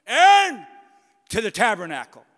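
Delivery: shouted name up high, clipped order after; booming divine call to Aaron; God's stern command. Acted. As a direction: bark the summons like a furious God. God summons Aaron sharply.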